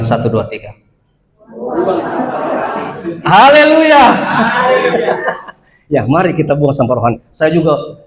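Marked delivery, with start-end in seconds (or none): 1.5-3.2 slow laughing repeat of the phrase. Real, not acted. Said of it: "Katakan kiri kananmu". 3.2-5.5 laughing hallelujah. Real, not acted. Congregation exclaiming after exercise.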